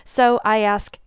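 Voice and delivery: normal talking voice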